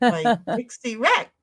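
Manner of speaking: still-clueless sounding-out of "Dixie wrecked"; puzzled misreading. Retold or imitated. Streamer still missing the joke in chat, questioning "Dixie wrecked". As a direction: repeat "Dixie wrecked" as if it were a name, rising at the end. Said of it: "And it never connects".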